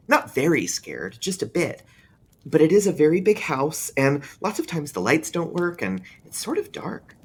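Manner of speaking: put-on a child explaining his fear in full earnest; young boy, grave and a bit scared; earnest small boy. A narrator's reading. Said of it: "with intense seriousness".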